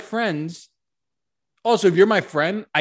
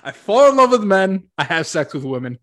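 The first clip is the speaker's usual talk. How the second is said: quoting a comedian; 4 semitones higher, 7 dB louder than usual. Retold or imitated. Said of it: "To quote Chris Stefano".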